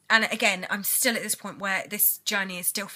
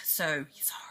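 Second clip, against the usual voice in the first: hushed, breathy apology; whispered sorry; 5 semitones lower, 7 dB quieter than usual. Real, not acted. Apologising to viewers for a late video, whispered. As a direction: whisper it, a little sheepish.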